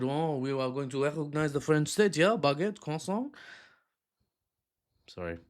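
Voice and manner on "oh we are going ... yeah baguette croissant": cartoon French, baguette and croissant; mock French accent. Vlogger mocks France announcing recognition in French accent. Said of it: "then France came out and said".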